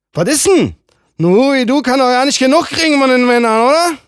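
hoch und laut, spöttisch höhnend; höhnischer Mann. Acted. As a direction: verspotte sie grob. Mann verhöhnt sie. (laut und höhnisch)

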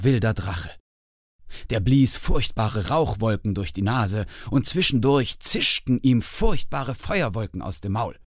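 ordinary delivery, nothing marked

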